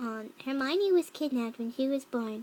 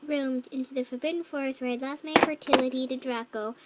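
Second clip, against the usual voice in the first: ordinary delivery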